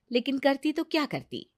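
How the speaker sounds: plain reading voice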